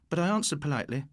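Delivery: ordinary delivery, nothing marked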